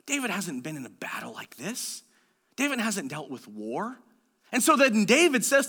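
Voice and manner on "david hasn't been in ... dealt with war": low, soft understatement; hushed aside. Real, not acted. Preacher pointing out David's inexperience, hushed. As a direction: drop right down, quiet and low.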